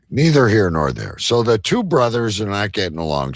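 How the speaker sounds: usual speaking voice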